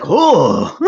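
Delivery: high, loud mock cry of alarm; alarmed recognition. Restaurant staff pointing at returning culprit, mock alarmed cry. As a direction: let out a big imitated cry of recognition.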